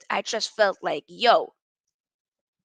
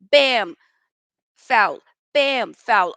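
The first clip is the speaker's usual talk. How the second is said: loud, punchy "bam, foul"; mock referee calls; 7 dB louder, much slower than usual. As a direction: slam each "bam" and "foul" like a whistle blow. Mimicking referee calling fouls.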